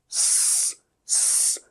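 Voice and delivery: स as a soft whistling hiss, teeth almost together; स hissed softly, teeth nearly shut; hissed s sound. Shown as a demonstration. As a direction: push air out through the teeth for स. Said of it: "सीटी वाला".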